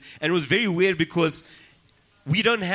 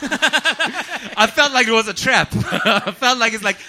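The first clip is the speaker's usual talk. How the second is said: laughing; 7 semitones higher, 6 dB louder, slower than usual. Real, not acted. Laughing at the DJ trap joke.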